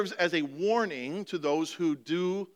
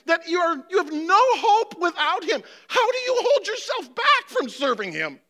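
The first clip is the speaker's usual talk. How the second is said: real emotion cracking a climbing plea; emotional; 10 semitones higher, 6 dB louder than usual. Real, not acted. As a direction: voice breaking with emotion, rising higher and louder. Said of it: "I'm emotional today".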